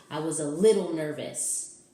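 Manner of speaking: ordinary delivery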